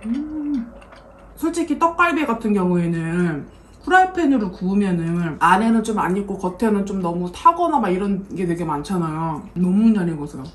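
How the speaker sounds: eating between words; talking while chewing. Real, not acted. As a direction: mouth full of meat. Seen 4: She chews bites of meat patty while talking, not noodles.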